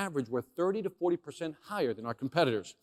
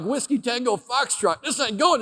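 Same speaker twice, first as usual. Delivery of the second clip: inner alarm; 8 semitones higher, 4 dB louder than usual. Retold or imitated. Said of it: "I'm like".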